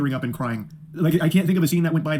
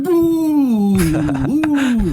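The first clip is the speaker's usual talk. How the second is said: much higher, loud spooky shriek; jump-scare boo; 8 semitones higher, 7 dB louder than usual. Retold or imitated. Crouched woman jump-scaring [name], loud high spooky boo. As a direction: leap out at him with a ghostly boo. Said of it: "she goes, like".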